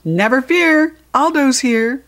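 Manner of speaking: big booming announcement, high and loud; roared. Acted. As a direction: roar it like a hero arriving. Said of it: "voice roared".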